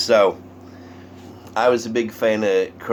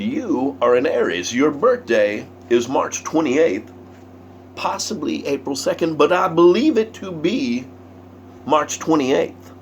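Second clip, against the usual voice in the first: mysterious prophecy; 7 semitones higher than usual. Retold or imitated. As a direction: savor each word like a fortune teller. Colonel names birthday mysteriously.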